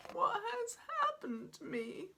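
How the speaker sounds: high crying wail. Acted. Sobbing T-Rex to the platypus doctor, wailing. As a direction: wail out in misery. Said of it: "wailed T-Rex".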